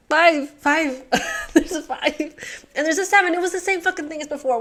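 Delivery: loud and high, rushing; triumphant burst; excited outburst. Real, not acted. Solving the puzzle at last, loud and high.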